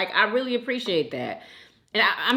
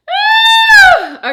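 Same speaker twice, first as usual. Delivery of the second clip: high, loud wail; emotional wail; 14 dB louder than usual. Real, not acted. Host moved by a viewer's praise, wailing. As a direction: cry out as emotion overtakes you. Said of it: "I'm getting emotional".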